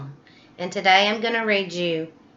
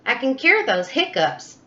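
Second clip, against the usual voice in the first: eager chimp voice; 6 semitones higher than usual. Acted. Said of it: "Chimp said".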